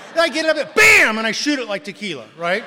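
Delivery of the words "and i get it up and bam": loud, high burst landing on bam; excited shout. Real, not acted. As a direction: shout it as the glass goes up. Downing the cognac in one shot.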